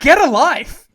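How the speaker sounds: loud, high acted outburst; shouting. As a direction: shout it off as a parting insult. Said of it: "just ends the segment just shouting".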